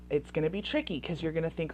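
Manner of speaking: normal talking voice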